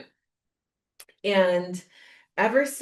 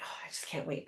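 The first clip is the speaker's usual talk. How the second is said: hushed giddy whisper; excited whisper; 6 semitones lower, 15 dB quieter, faster than usual. Retold or imitated. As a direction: breathe it out in secret excitement. Retold own excited whisper.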